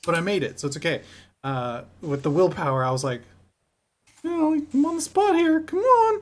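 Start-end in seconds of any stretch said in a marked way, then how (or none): 4.2-6.2 high-pitched pleading, pushing the voice up. Retold or imitated. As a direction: beg the dice in a high squeak. Pleading with the dice.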